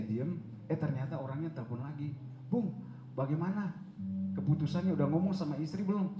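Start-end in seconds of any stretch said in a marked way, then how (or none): 2.5-6.1 high, louder retold caller; eager pressing. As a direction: imitate a friend chasing an answer. (mendesak dengan nada tinggi)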